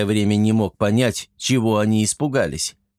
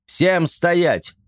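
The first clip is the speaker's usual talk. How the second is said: high commanding shout over noise; 6 semitones higher than usual. A narrator's reading. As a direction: stop everyone with one yell. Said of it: "перекрикивая всех, закричал". (командный крик)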